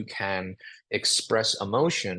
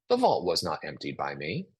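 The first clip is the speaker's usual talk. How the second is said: calm, defused; 6 semitones lower than usual. Shown as a demonstration. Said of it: "This really diffuses a situation".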